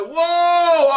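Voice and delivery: sudden high alarmed whoa, loud; startled whoa. Retold or imitated. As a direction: jump back with a loud scared whoa. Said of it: "I'm like".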